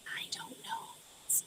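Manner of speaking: unvoiced whisper. Real, not acted. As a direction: hush it like a secret. Whispering before opening jar.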